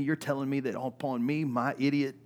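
ordinary talk, nothing marked

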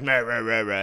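mock his nagging as noise; loud derisive babbling; mocking blather. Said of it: "he was like".

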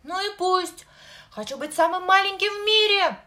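high, loud crying shout; angry crying. Acted. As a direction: yell it through tears, defiant. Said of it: "сквозь слёзы кричал малыш". (сквозь слёзы кричал малыш)

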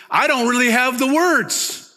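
a hint of a thrilled apostle straining for words; excited Paul. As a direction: let the joy spill over as Paul. Paul voiced excitedly, high.

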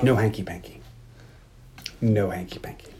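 low, soft, firm retelling; mock stern rule-setting. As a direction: lay down the rule quietly. Retells him setting ground rules.

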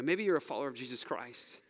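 usual speaking voice

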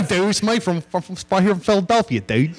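mock Philadelphia accent on "dude"; Philly accent. Comedian imitates a Philadelphia wrestler in a Philly accent. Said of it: "That's a Philly accent".

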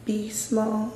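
soft, high inner voice; a quiet voice inside the head. Retold or imitated. A voice in her head telling her, soft and high. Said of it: "I heard a voice in my head".

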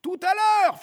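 loud, high-pitched urchin's call; Gavroche. Acted. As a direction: shout back breezily over the distance. Gavroche calls back from street.

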